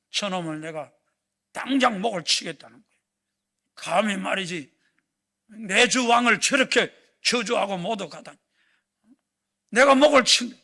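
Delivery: loud, furious soldier's vow; Abishai's fury. Acted. The general Abishai begs to kill the cursing man, in a loud furious vow. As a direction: bellow the threat to behead him. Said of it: "아비새가 그러는 거예요".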